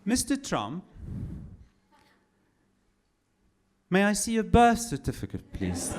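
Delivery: polite British official asking for papers; mock British bureaucrat, syrupy courtesy; British accent. British accent, embassy officer grilling Trump over papers. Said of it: "I'm doing a British accent".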